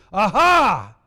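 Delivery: loud demonstrated Hebrew cry of distress. Shown as a demonstration. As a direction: call it out as a mock alarm. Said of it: "it is a term of great distress".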